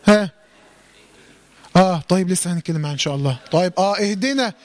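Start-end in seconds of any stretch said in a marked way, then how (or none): none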